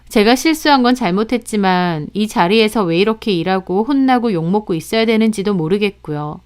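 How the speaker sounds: usual speaking voice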